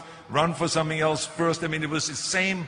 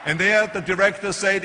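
ordinary delivery

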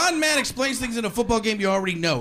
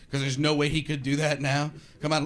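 ordinary talk, nothing marked

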